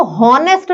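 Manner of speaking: "हॉनेस्ट" with the h sounded; wrong pronunciation. Shown as a demonstration. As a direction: say honest wrongly as "हॉनेस्ट", h pronounced. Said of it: "हॉनेस्ट नहीं होता".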